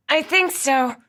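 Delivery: through clenched teeth. Acted. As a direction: force it out while straining. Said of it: "she managed, her teeth clenched".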